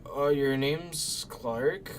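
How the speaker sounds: slow slurred tipsy drawl; drunk slurring. Retold or imitated. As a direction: stretch the words like a drunk guest. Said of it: "slurring her words so hard".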